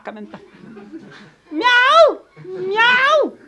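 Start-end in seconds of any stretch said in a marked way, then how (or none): 1.5-3.4 loud high mock meow; cat imitation. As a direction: meow to scare the dog. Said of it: "il a peur des chats". (miaulement imité pour effrayer le chien)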